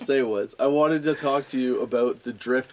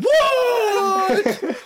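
high, loud crowd shout; excited crowd yell; 6 dB louder than usual. Retold or imitated. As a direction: shout back like a hyped crowd. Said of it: "everybody's like".

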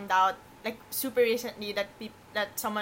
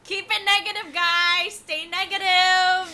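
high, loud pep-talk call; mock cheerleading; 8 semitones higher, 8 dB louder, slower than usual. Host rallying the group after gloomy covid talk, mock cheer. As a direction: cheer them on, stretching the words.

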